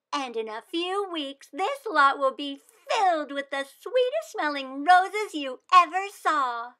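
put-on earnest little girl sure of her plan; hopeful child; eager young Wanda. Wanda promises roses.

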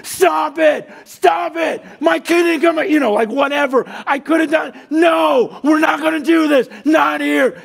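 high, loud pleading yell to stop the fight; imagined shout. Retold or imitated. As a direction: yell it out across the field, begging them to stop. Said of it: "I regret not running on the field and just yell something".

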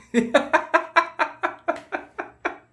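high manic cackle breaking out; a wild unhinged laugh. Acted. Obsessed extra cackling at the star he blames.

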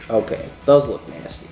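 normal talking voice

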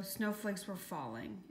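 plain reading voice

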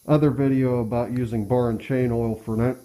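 usual speaking voice

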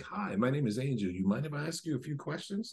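softly voiced friendly introduction; polite approach. Reporter approaching people for questions, politely softened voice. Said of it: "walk up to people and be like".

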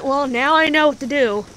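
ordinary talk

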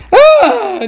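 sudden high scream of fright. Real, not acted. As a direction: shriek as she's caught. Caught by Granny, screams. (grito agudo de susto)

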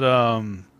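ordinary talk

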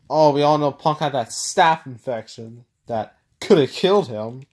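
usual speaking voice

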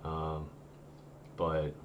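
ordinary talk, nothing marked

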